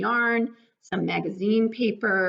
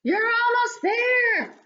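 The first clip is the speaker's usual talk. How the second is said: much higher, louder cheering bug voice; cheering parent bug; 13 semitones higher, 3 dB louder, slower than usual. Acted. Parent bug cheers the baby flying, high and excited. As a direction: cheer the little one through its first flight.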